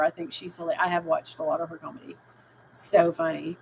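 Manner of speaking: ordinary delivery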